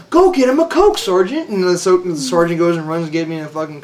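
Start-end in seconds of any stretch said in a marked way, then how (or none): 0.1-1.5 mock grand order. Sergeant ordering mockingly.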